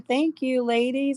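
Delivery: ordinary delivery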